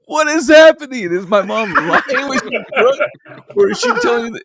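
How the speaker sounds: cracking up in confusion; hammed-up bewilderment; laughing disbelief. Retold panic, laughing.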